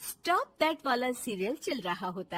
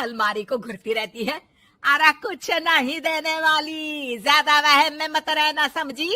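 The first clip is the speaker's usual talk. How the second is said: very loud saas tirade; shouted, domineering telling-off; furious mother-in-law; 4 semitones higher, 13 dB louder than usual. Acted. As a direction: scold and dismiss the bahu at full volume.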